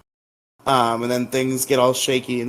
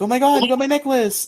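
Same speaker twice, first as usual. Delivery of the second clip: high delighted squeal; a high, thrilled girlish gasp of delight; excited squeal; 12 semitones higher, faster than usual. Retold or imitated. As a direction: squeal with joy at the find. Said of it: "she's like".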